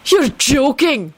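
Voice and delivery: high, drawn-out disbelief; thrilled, incredulous cry; delighted holler. A narrator's reading. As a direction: exclaim it high and slow with delight. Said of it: "Benji hollered delightedly".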